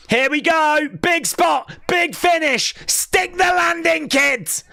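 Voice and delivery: shouted high play-by-play; wrestling commentator. Real, not acted. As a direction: yell the big move like a hyped commentator. Hyped live wrestling call.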